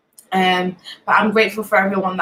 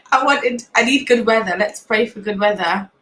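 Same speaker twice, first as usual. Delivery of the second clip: ordinary delivery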